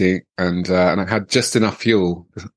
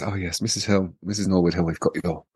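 soft, discreet phone-call voice; imitated receptionist phoning; 5 dB quieter than usual. A and E woman phoning the wife, discreetly quiet. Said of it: "she goes".

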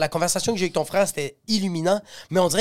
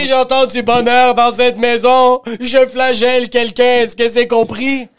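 menace criée; 6 semitones higher, 9 dB louder than usual. Pretend. Tyran domestique imité, crié.

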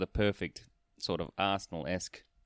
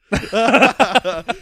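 high, loud laughing fit; real laughter rising high; big burst of laughter; 13 semitones higher, 15 dB louder than usual. Real, not acted. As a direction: crack up loudly. Laughing at the joke about Henderson.

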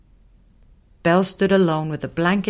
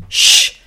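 sharp shush; 10 dB louder than usual. Acted. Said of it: "He looked sharply at Belle".

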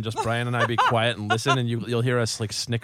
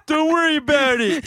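silly squeal voice; 6 dB louder than usual. Acted. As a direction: squeal it out like a cartoon taunt. Put-on silly voice, joke confession.

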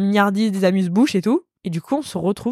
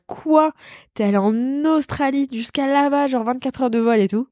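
higher, amazed question; retold shocked star, higher pitch; surprised; 5 semitones higher than usual. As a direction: sound astonished at the trip. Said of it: "t'es en mode".

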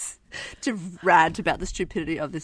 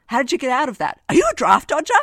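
builds louder and higher, question by question; mock interrogation of the journalist, rising to a shout; mock grilling; 7 semitones higher, 9 dB louder than usual. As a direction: pile on the questions with growing outrage. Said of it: "He's like".

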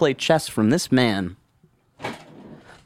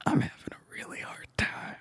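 whispering; 12 dB quieter, faster than usual. Retold or imitated. Confesses struggle to parents.

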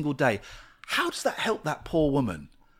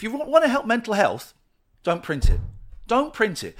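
angry; 7 semitones higher, 3 dB louder than usual. Real, not acted. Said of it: "so I'm getting so angry".

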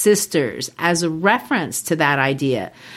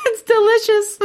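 laughing. Real, not acted. Joking about witches' stew.

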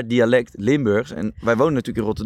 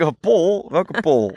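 higher, playfully puzzled; mock confusion; 6 semitones higher, much slower than usual. Answering the Limburg friend, mock confused. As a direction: feign not knowing who Pol is. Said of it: "En dan zeg ik".

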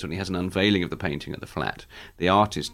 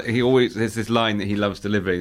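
normal talking voice

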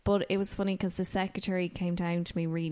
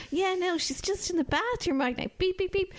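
much higher, hammed-up boyfriend hanging up on callers; controlling boyfriend; 11 semitones higher than usual. Husband cutting off a worried caller, hammed-up controlling boyfriend. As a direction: brush them off and mock-hang up. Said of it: "he really sounded like a [name]".

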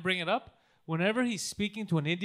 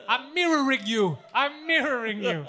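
slow, loud, raised put-on voice; mock salesman; 5 semitones higher, 6 dB louder than usual. Salesman explaining himself to customer, slow hammed-up voice. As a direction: stretch it out loudly, hammed-up.

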